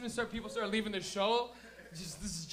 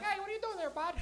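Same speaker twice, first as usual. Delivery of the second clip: much higher mock woman's voice with a Maritime lilt; 10 semitones higher than usual. Comic imitating a Halifax woman, high mock female Maritime voice. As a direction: squeak it out like a friendly Halifax lady. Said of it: "Even the women will be like".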